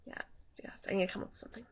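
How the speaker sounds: hushed, low whisper; whispering to self. Real, not acted. Muttering to self while searching.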